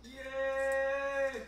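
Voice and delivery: high, loud cheer; a delighted yay for the big moment; loud happy cheer. Real, not acted. Cheering the potato reveal.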